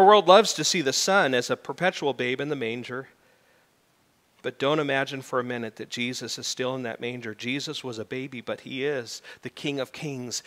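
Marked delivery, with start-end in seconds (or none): none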